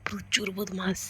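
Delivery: soft fond whisper; quiet whisper. Real, not acted. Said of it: "তাই আমি আস্তে আস্তে কথা বলছি".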